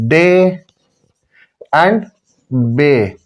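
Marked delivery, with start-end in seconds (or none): none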